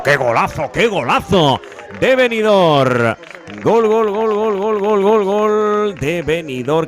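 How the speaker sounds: high, loud stretched goal call; goal scream. Real, not acted. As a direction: erupt with a drawn-out gol. Goal scored, commentator erupts.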